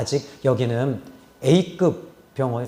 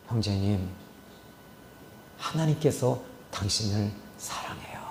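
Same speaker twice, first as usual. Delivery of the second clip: whisper; 6 semitones lower, 10 dB quieter than usual. Retold or imitated. Pastor whispering to dying patient.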